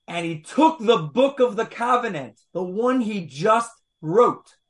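"the one he just wrote" slowed and punched; emphatic reading. Shown as a demonstration. Teacher rereading the verse carefully, pointed stress on "just wrote". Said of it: "I'll put the emphasis on the right syllable".

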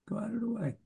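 whisper. Real, not acted. Muttering aside while searching screen.

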